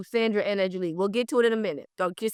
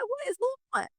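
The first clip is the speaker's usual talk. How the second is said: high, incredulous squeal of a question; shocked disbelief; 12 semitones higher, 8 dB quieter than usual. Retold or imitated. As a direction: ask it like you can't believe your ears, pitched way up. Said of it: "I'm like".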